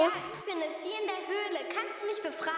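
far off, from a cave. Acted. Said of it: "Mia antwortete aus der Höhle".

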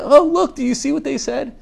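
very high, excited gushing; delighted parent. Retold or imitated. Parent showing off the baby's babbled words, high excited voice. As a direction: marvel loudly, then squeal the question. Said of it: "and they're like".

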